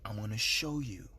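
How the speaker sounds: very deep and soft, a distant otherworldly boom; the Lord's voice. Retold or imitated. The Lord promising to show him paradise, in a very deep distant voice. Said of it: "That's, that's how he said".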